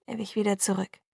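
ordinary delivery, nothing marked

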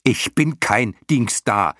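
high, loud, slow and emphatic; fairly furious shout. A narrator's reading. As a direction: hammer out the protest angrily. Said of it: "schrie Bastian nun doch ziemlich wütend". (ziemlich wütend geschrien)

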